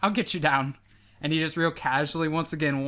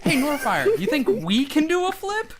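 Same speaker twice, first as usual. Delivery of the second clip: laughing; 11 semitones higher, 4 dB louder than usual. Acted. Edward teasing, laughing.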